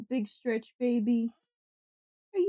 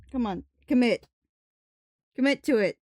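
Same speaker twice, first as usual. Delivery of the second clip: louder call to commit; urging cheer, louder; 4 dB louder, faster than usual. Real, not acted. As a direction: egg the cat on, louder. Urging the cat on.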